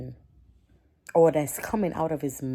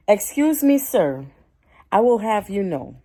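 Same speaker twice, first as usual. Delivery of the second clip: loud, high, indignant comeback; a stranger rants in a shop, she fires back loud; indignant retort; 5 semitones higher, 9 dB louder than usual. Pretend. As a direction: put him in his place, loud and high.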